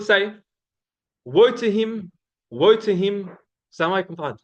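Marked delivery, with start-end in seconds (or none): 1.2-3.3 raised, louder "Woe", drawn out then dropping; emphatic repetition. Acted. Speaker quoting the Prophet to hook listeners, emphatic repetition. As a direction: repeat "Woe to him" as a grave hook. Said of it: "He would repeat things".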